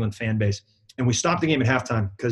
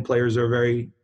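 ordinary talk, nothing marked

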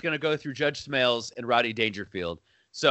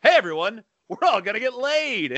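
mock yell; 8 semitones higher than usual. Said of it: "just yells".

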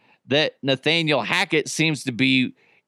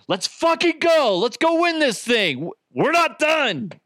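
fired-up teammate yell; 11 semitones higher than usual. Retold or imitated. Imitating a teammate yelling hype at the quarterback, fired up. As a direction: yell it to get the whole team going. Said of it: "Purcell is just full of energy going".

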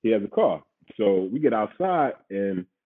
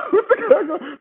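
words squeezed out through laughter, much higher; laughing through words. Real, not acted. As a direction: laugh as you repeat it. Laughing while retelling.

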